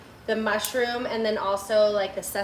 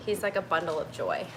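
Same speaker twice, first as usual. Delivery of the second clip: ordinary talk, nothing marked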